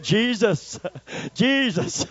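high, earnest calling on Jesus; reverent plea. Retold or imitated. Preacher retelling his plea to Jesus. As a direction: cry the name out to God for help. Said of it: "I didn't say it like the world says it".

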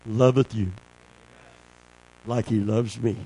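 low, soft, intimate preaching; hushed preaching. Real, not acted. Preacher speaking softly of the Father's love. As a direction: drop to a tender hush.

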